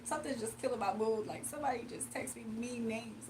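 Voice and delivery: soft, put-on dejection; mock hurt. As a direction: play wounded for the audience, voice sinking low. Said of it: "he wants me to be like this".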